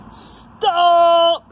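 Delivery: high, loud pirate command; loud call. Acted. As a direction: call out to stop everyone. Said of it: "called Hank Chief".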